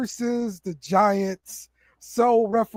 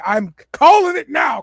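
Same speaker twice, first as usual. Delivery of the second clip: loud, raised declaration; emphatic call; 6 semitones higher, 7 dB louder than usual. Real, not acted. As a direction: call it out boldly. Makes an emphatic prediction.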